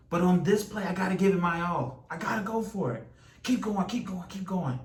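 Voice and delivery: fired-up runner's pep talk; determined self-urging. Acted. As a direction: psych yourself up to give everything. Runner rallies self to keep going.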